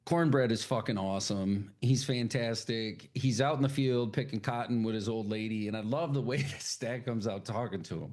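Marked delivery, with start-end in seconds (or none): none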